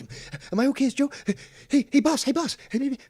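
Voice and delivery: a mock grovelling fan sucking up to the boss; mocking Joe. Host mocks caller Joe fawning over the boss, in a high whiny voice.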